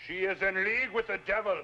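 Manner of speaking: forceful denunciation. Acted. Man denouncing widow to the elders, forceful accusation. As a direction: proclaim it for all the elders.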